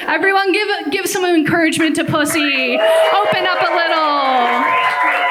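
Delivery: rally the room, shouting encouragement; calling out to the crowd. Acted. Hyping the crowd for Pussy.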